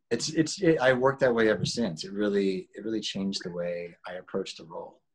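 usual speaking voice

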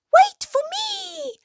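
very high, tiny squeaky call; dormouse's cry. A narrator's reading. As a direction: squeal it out as a little mouse left behind. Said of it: "cried Dormouse".

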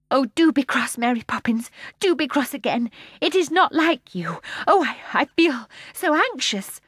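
put-on high, urgent child's plea; anxious pleading. A narrator's reading. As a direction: beg her, worried. Michael begs Mary Poppins anxiously.

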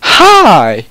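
high, loud, drawn-out flirty greeting. A narrator's reading. Ebony greeting Draco, exaggeratedly flirty. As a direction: stretch it out flirtily, high then sliding down. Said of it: "I replied flirtily".

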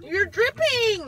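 slow loud warning; alarmed shout. Real, not acted. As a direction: call it out in alarm. Ice cream dripping alarm.

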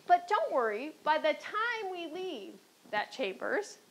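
ordinary talk, nothing marked

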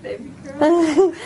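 choked up with happy tears; crying through a laugh; tearful. Real, not acted. Hearing aid switched on, emotional.

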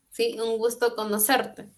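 ordinary talk

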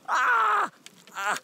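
sharp high cry of pain; sudden pained yelp. Real, not acted. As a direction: yelp as the knife goes in. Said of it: "I just stabbed myself with a knife".

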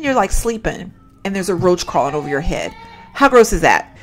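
ordinary talk, nothing marked

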